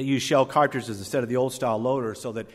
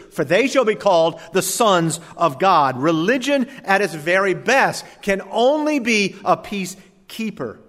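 rising, louder preacher's cadence; preaching; 6 semitones higher, 6 dB louder than usual. Acted. Preacher building his point to the congregation, loud sermon cadence. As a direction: preach it out with force.

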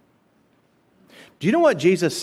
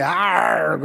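pirate arr; 3 semitones higher than usual. Retold or imitated. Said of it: "that pirates say".

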